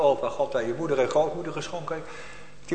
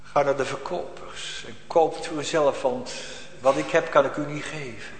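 usual speaking voice

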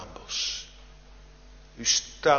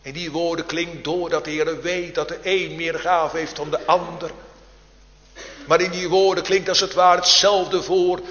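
higher and louder, building to a ringing call; fervent preaching; 8 semitones higher, 12 dB louder than usual. Acted. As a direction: preach it out, pleading and urgent, climbing in force. Sermon rising to full force.

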